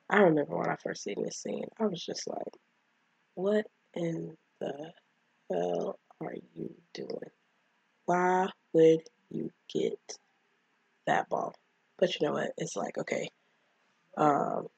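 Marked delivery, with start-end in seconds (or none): 3.4-11.5 drawn-out, word-by-word disbelief; slow incredulous questioning, pausing between words. Retold or imitated. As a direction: space out each word, stunned and exasperated. Retells own disbelief at the scene.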